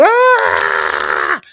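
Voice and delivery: loud, drawn-out cry of shock; mock scream. Peggy discovers the gnome is gone out front, mock horrified scream. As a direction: let out a long horrified wail.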